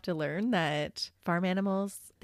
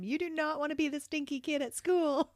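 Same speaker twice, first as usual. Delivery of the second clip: lightly acted parental advice, pitched higher; parent warning kids; 7 semitones higher than usual. Parent telling the kids about school, mild mock warning. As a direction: imitate yourself cautioning your children. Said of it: "tell my kids all the time".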